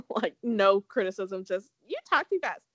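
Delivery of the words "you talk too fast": higher-pitched imitation of a fussing mother; mock mom. Podcaster repeating her mom's criticism, mock mother voice. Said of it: "that's a very mom thing to say".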